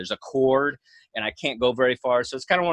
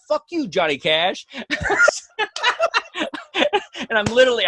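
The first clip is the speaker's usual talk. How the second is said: laughing; 12 semitones higher than usual. Retold or imitated.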